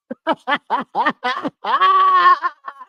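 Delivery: high-pitched, drawn-out cackle; burst of laughter. Real, not acted. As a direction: crack up hard, high and long.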